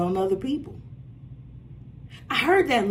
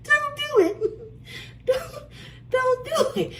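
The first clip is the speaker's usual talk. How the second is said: "Don't do it" squeaked much higher through laughter, slow; laughing repeat; slower than usual. Retold or imitated. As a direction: crack up while repeating the banker's "Don't do it". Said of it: "I was getting ready to do an accent".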